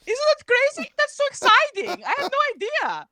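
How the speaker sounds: hammed-up excited prophet; mock excitement. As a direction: gush like a thrilled child. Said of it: "he gets all excited".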